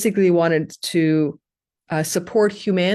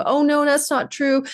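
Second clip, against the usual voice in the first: doubting listener; 9 semitones higher than usual. Retold or imitated. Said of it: "you go".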